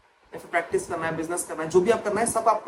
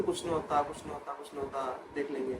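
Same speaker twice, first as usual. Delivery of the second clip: soft reassuring dad; 4 semitones lower, 10 dB quieter than usual. Retold or imitated. Dad reassuring him, retold.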